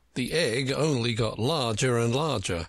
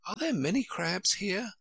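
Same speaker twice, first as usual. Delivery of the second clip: put-on curious child; 8 semitones higher than usual. A narrator's reading. Alice asks the sheep.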